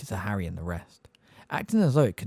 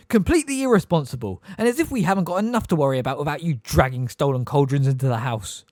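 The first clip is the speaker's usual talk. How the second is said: loud, high, scolding shout that eases at the end; a mother tearing into a guest; furious tirade; 5 semitones higher, 5 dB louder than usual. Acted.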